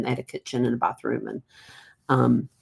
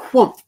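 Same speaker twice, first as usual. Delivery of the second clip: whoomp; 10 semitones higher, 9 dB louder than usual. Retold or imitated. Brother hit by surprise.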